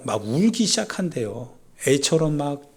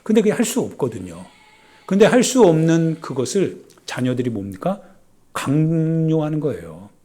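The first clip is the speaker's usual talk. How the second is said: usual speaking voice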